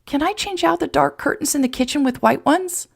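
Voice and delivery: very high, overcome with joy; ecstatic through tears. A narrator's reading. Emma accepting life on Jason's ranch, tearfully ecstatic. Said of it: "Emma hiccuped and cried and laughed".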